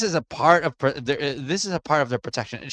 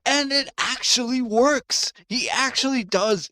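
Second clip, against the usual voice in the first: amazed; 10 semitones higher than usual. Real, not acted. Marvelling at musician's success.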